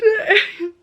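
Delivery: a wet laugh that breaks into a sob; teary half laugh, half sob; laughing through tears. Real, not acted. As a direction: laugh while really crying. Crying while laughing at herself.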